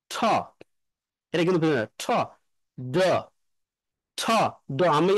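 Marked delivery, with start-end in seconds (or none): 0.1-0.1 ঠ voiceless, the vocal cords still; unvoiced ঠ. Shown as a demonstration. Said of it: "যেমন". 2.0-5.1 ঠ then ড, the vocal cords off then on; voiceless and voiced pair. Shown as a demonstration. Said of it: "এগুলো পিয়ার sound".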